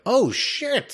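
high, thrilled exclamation; imitated delight pitched high; mock awed excitement. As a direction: gush like the music just hit you. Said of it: "And I'm like".

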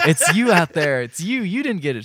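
high, loud and laughing; laughing mock accusation. Retelling the assembly crowd, laughing. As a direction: accuse her through a laugh.

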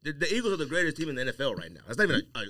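normal talking voice

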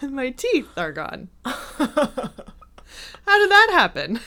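high, laughing through a woozy realization; dazed laughing. Retold or imitated. Woman retelling how she woke to smashed teeth, dazed laughing. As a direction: act out a dazed, amused reaction to disaster. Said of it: "I just kinda laugh".

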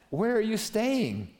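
high, slow, awkward mock question; choking under pressure. Andrew choking as he asks Jesus, awkwardly high. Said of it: "he choked. The pressure was too much".

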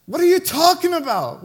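high, loud whiny protest; the fed-up son pushing back; exasperated kid. Retold or imitated.

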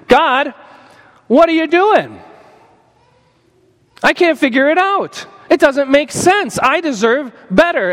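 high, whining complaint to God; mock protest aimed at heaven; prideful complaint. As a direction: raise the pitch in an indignant gripe.

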